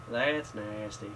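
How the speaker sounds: slow, low drawn-out character voice; lazy, low-pitched cartoon-style impression; Cleveland. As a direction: stretch each word out, low and soft. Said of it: "In your best Cleveland voice".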